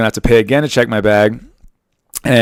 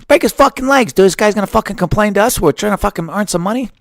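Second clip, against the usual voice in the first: much higher put-on voice, a barking thug giving orders; mobster goon; 11 semitones higher than usual. Spirit Airlines boss ordering a beating, in a hammed-up high thug voice. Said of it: "Spirit Airlines would have been like".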